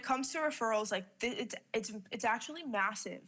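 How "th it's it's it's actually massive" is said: usual speaking voice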